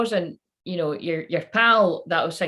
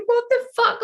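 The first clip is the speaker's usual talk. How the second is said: what the fuck shot up very high and louder; high, loud cry of disbelief; outraged disbelief; 4 dB louder than usual. Retold or imitated. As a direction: squeal it in disgusted shock. Retold outrage at the film ending.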